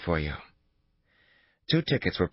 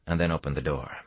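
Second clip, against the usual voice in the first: usual speaking voice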